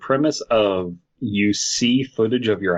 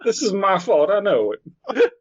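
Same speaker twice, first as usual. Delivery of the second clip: high slurred cartoon dog; drunk Goofy voice; 9 semitones higher than usual. Voicing Goofy.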